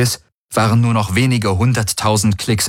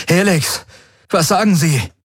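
urgent question; 10 semitones higher than usual. Acted. Galen demanding answer.

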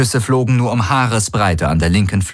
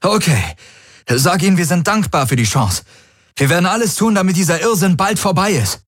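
urgent gratitude; 11 semitones higher than usual. Acted. Galen accepting terms.

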